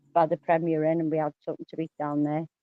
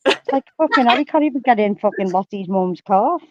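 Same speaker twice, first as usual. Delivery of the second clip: ordinary delivery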